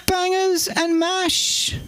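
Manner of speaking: high, slow regal announcement; high-pitched, stately monarch impression; the Queen. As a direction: draw the dish out in a high royal voice. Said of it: "Thanks to the Queen".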